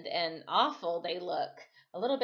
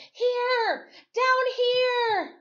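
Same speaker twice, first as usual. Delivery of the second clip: high, loud desperate yell; yelling to the sky; 9 dB louder, much slower than usual. Acted. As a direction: call up to the plane with everything. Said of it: "I yelled toward the sky".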